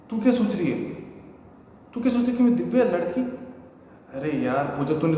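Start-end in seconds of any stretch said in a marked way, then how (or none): 0.1-3.4 high, disbelieving scoff; mock disbelief, pitched up; incredulous husband. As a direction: laugh off her silly suspicion. Husband scoffing at wife's suspicion.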